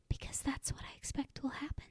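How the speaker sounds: soft, gentle whisper. A narrator's reading. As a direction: breathe it close to his ear. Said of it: "As she whispered quietly".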